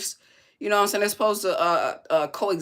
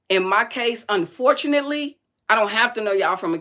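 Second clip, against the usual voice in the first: rising indignation; 4 semitones higher, 6 dB louder than usual. Real, not acted. Lecturing a commenter, voice rising.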